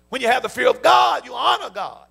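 loud, high preacher's shout; rising preacher's shout; preaching at full force. Real, not acted. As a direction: drive it home to the congregation.

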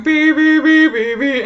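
high loud beeping; imitated phone beep. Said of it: "two phones that are going".

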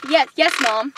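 ordinary talk, nothing marked

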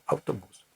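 «автобус» з наголосом на останній склад; іспанський наголос. Shown as a demonstration. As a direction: stress the final syllable of «автобус»: au-to-BUS. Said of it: "повинен бути оцей значок наголосу". («автобус» як автоБУС)

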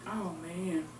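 ordinary talk

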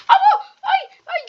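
sudden high, loud shriek of alarm; startled yelp. Real, not acted. Gamer startled by a mob, high loud shriek. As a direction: jump and shriek at the fright.

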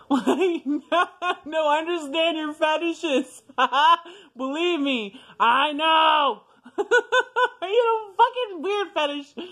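very high, loud laughing fit; cracking up. Real, not acted. Speaker joking with viewers about weird fetishes, cracking up. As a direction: squeal the words out through real laughter. Cracking up over own confession.

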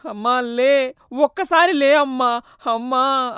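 slow, loud, high wailing over his dead mother; acted sobbing lament; wailing sob. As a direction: howl it out, grief-stricken. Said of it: "బోరున ఏడుస్తున్నాడు".

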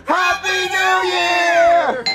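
high, drawn-out cheer; long, high party yell; celebratory shout. Acted. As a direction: belt it out to ring in the year. New Year celebration shout.

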